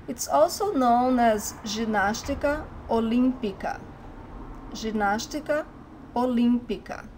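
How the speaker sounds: ordinary talk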